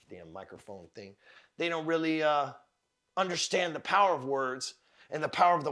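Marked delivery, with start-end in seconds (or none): 0.1-1.2 very low, much softer grumble under the breath; muttered aside. Real, not acted. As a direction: drop right down, as if to yourself. Muttered aside about microphone.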